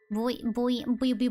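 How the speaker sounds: usual speaking voice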